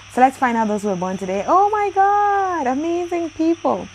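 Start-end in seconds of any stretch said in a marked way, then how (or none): 1.5-3.9 high, drawn-out real excitement; amazed delight. Real, not acted. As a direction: gush in awe, stretching the words high. Reacts to list of birthday people.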